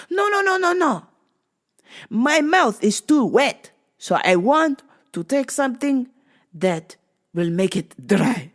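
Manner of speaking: earnest lady with a strange request; lightly accented old lady; foreign lady voice. Retold or imitated. Lady insisting in retold story.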